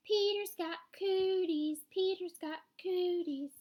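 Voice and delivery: high, loud, drawn-out schoolyard chant; yelled taunt. A narrator's reading. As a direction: tease loudly, stretching each word. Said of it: "she yells".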